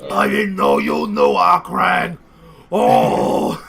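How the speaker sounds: very low, loud, booming voice; dragon turtle. Acted. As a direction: rumble it out like a giant beast.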